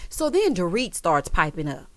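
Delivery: ordinary delivery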